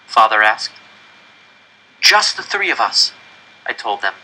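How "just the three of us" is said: put-on high, eager child's voice selling the idea; eager pleading. Janek urging his parents to move into the coop, eager. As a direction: convince them, bursting with hope.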